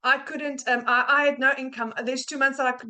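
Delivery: ordinary delivery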